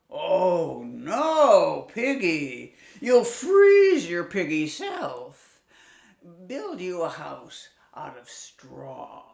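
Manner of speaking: loud, slow, fake-pitying wolf; a wolf pretending to care; slow sly wolf with fake sympathy; mock-concerned wolf. Wolf tricks the pig.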